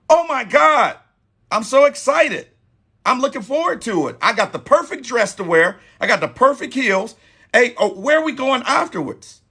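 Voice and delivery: imitating a thrilled woman accepting a date; excited woman. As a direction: bubble over with joy at the invite. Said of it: "That is a woman that is excited".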